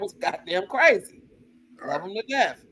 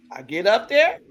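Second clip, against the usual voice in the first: normal talking voice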